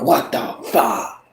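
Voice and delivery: slow, quiet what the fuck, falling; awed exclamation. Retold or imitated. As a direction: say it in hushed amazement. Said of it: "And other people are like".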